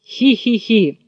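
sly giggling fox; sly fox giggle. Acted. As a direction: titter like a cunning fox.

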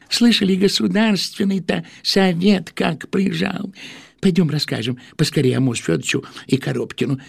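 lightly voiced eager townsman; excited gossip. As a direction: chatter high and quick. Bobchinsky voiced.

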